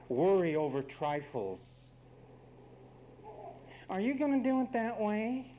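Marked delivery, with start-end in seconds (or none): 3.9-5.5 high, nagging question; mock nagging wife. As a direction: needle him like a fussing wife.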